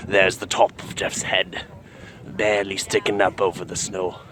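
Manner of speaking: hushed, low wildlife narration; nature documentary narrator. Acted. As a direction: murmur like a nature film host.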